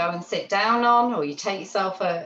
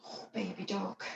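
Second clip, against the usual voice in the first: put-on much softer, cooing baby talk; tender baby talk; 12 dB quieter than usual. Shown as a demonstration. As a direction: coo at the dog as if holding a baby duck. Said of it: "Like this, yeah?".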